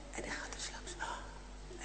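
whispered, tense narration; suspense whisper. A narrator's reading. Narrator whispering while the soldier checks the list. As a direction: whisper as if afraid to be heard.

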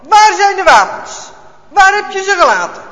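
loud, high, furious questioning; bellowing officer. A narrator's reading. Officer roaring at grandpa about hidden weapons, furious. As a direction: shout him down, demanding the weapons. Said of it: "half in het Duits, half in het Nederlands brult hij".

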